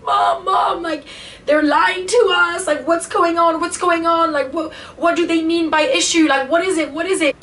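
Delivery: high-pitched, whining plea to mom; retold crying panic. Scared kid asking mom what's going on, retold in high panic. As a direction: imitate a child freaking out, pitch held high. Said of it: "I'm crying. I'm like".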